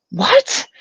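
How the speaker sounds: high startled what; shocked disbelieving what; surprised. Retold or imitated. Husband hears the story, stunned. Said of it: "And he's like".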